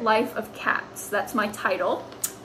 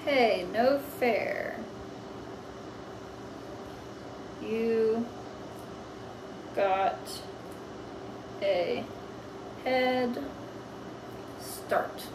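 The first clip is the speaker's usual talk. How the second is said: softer, slow, word by word with long gaps; slow dictation; 9 dB quieter, much slower than usual. Shown as a demonstration. Teacher writing the second cat's words down slowly. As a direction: dictate it slowly as you write. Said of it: "slow dictation while writing".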